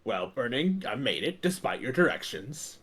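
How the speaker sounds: a low, hushed take on Mystic; Mystic impression. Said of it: "I'll do my missing impression".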